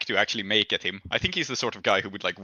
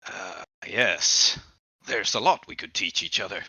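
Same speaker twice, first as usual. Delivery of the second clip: low, smug, suggestive reply; a touch of menace behind the smile; creepy smiling fighter; 3 semitones lower than usual. Acted. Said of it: "he says".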